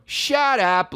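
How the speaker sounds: high hammy stage line; high-pitched mock dismissal; overdone actor's shut up. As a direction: imitate the co-star's big retort. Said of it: "the Mexican guy was going".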